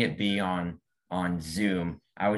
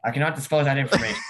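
mock official; 6 semitones higher, 6 dB louder, faster than usual. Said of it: "dodging question".

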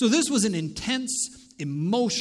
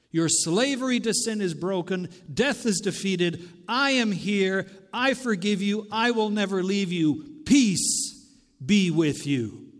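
firm proclamation building to a loud finish; emphatic declaration; 4 dB louder than usual. Acted. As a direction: proclaim each statement with authority, building. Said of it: "He is making a statement".

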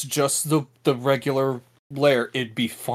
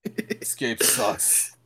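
groaning whisper; much slower than usual. Real, not acted. Groaning about the game.

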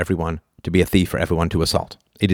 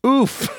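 oof. Real, not acted. Reacting to a shocking claim.

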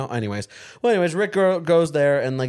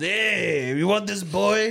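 caricature voice; 5 semitones higher, much slower than usual. Retold or imitated. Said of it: "What was that just caricature of a, of a guy?".

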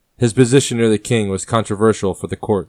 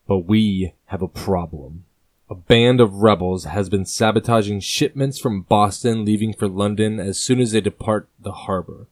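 ordinary talk

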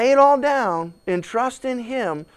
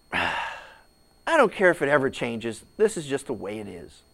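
resigned; 6 semitones lower, 4 dB quieter than usual. Retold or imitated. Resigned, giving up on promise.

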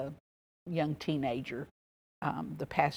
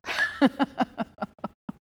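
laugh; 9 semitones higher, 6 dB louder than usual. Real, not acted. Laughing at own remark.

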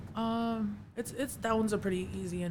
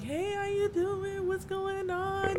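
much higher, slow sweet mom voice; much higher slow imitation of a mellow mom; happy mom; 12 semitones higher, slower than usual. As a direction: coo it like a relaxed mom.

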